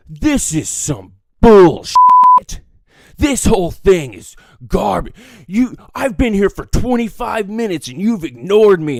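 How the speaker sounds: loud, high customer rant; acted outrage. Ignored customer to bartender, angry outburst. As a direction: explode in indignation, leaning hard on words.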